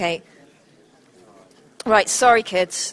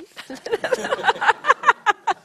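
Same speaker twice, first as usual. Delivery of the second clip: high laugh; 4 dB louder than usual. Real, not acted. Laughs after teasing audience.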